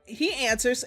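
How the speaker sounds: usual speaking voice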